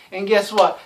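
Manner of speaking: normal talking voice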